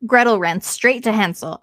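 ordinary delivery

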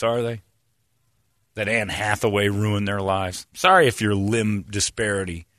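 ordinary talk

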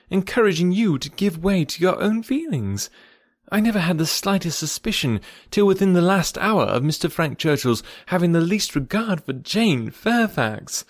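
self-justifying character voice; Emma, earnest friend. Acted.

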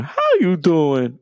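lightly voiced talk-show host; Wendy greeting Whitney, drawn out; Wendy Williams catchphrase. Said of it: "You know".

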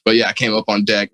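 normal talking voice

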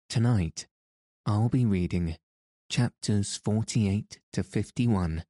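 calm them gently toward sleep; sleep story narrator. Acted. Sleep podcast host intro.

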